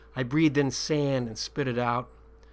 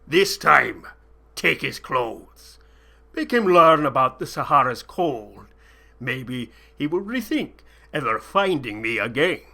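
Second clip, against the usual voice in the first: snarling sheik; 7 dB louder than usual. A narrator's reading. Sheik snarling a cruel threat at the captive. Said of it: "he snarled at me".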